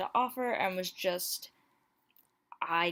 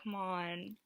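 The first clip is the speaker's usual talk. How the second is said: ordinary delivery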